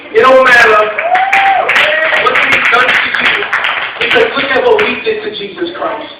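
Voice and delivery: loud, driving preacher's call; preaching at full force. Acted. Preacher urging the congregation to forgive everyone, loud and rising. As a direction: build it up and hammer each name home.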